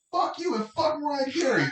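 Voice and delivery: high, snapping shout; angry yell. Retold or imitated. Fed-up crew member snapping at the assistant, angry yell. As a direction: blow up and yell it. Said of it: "he heard me yell".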